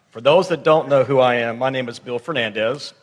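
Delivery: normal talking voice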